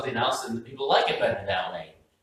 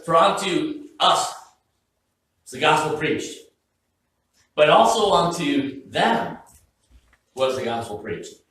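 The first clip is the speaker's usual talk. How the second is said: scripture reading; 4 semitones higher, 5 dB louder than usual. Acted. Reads scripture verse slowly.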